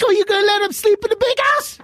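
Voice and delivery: hammed-up taunt; put-on teasing voice. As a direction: needle Michael in a silly character voice.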